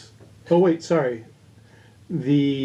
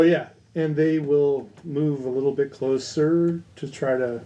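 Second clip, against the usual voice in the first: normal talking voice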